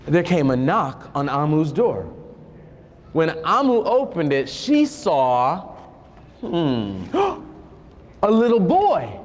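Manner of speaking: slow, storybook read-aloud; unhurried picture-book narration; reading to children. Acted. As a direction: draw the kids into the story, slowly.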